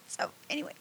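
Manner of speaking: breathy whisper to regroup; whispered aside. Real, not acted. Overcome retelling Disney Christmas snow, whispered aside. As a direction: whisper it, pulling herself together. Emotional, recovering composure.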